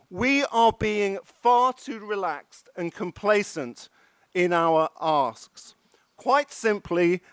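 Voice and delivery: usual speaking voice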